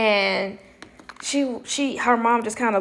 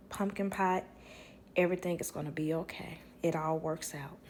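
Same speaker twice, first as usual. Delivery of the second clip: very soft, low reassurance; hushed and soothing; gentle comfort; 4 semitones lower, 10 dB quieter than usual. Retold or imitated. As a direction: murmur it like tender last words. Quotes grandmother's last comforting words.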